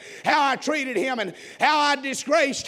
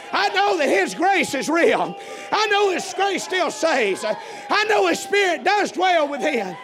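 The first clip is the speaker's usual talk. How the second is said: full-force preaching; 3 semitones higher than usual. Real, not acted. Preacher testifies to congregation what he knows, full-force preaching. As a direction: proclaim it to the congregation with rising fervour.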